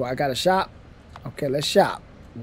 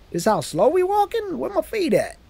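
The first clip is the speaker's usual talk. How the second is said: much higher, disbelieving squeak; incredulous; 11 semitones higher than usual. Real, not acted. Character walks too slowly.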